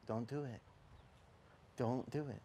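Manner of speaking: soft, hushed warning voice; a quiet voice of conscience; quiet inner voice. Retold or imitated. Preacher voicing the inner voice, hushed. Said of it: "that voice would go".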